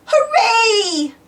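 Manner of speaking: high joyful hooray with laughter; cheering shout. A narrator's reading. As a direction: kids shouting with delight. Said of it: "shouted George and Peppa".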